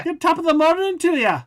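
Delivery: high, loud hammed-up leprechaun Irish accent. Host answers co-host in a hammed-up leprechaun Irish accent. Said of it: "fully leprechaun Irish right there".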